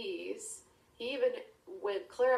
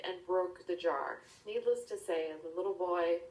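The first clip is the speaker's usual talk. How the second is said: plain reading voice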